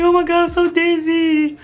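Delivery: high, loud dizzy whine; mock panicked wail. Dizzy kid crying out to mother, high loud wail. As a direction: wail it high and drawn along.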